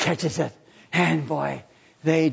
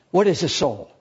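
ordinary delivery

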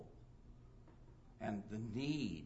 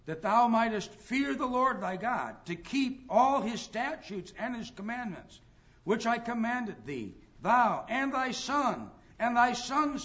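loud, high, solemn pulpit reading of scripture; 6 semitones higher, 9 dB louder than usual. Acted. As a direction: proclaim the old King James words, slowing at the end. Reads Deuteronomy aloud, preaching.

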